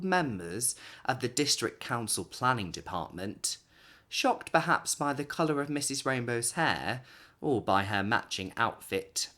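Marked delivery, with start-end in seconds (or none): none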